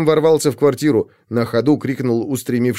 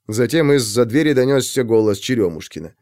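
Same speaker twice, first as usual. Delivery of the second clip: plain reading voice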